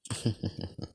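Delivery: soft villain snicker; hushed, amused evil chuckle; mocking chuckle. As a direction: chuckle quietly at a challenger.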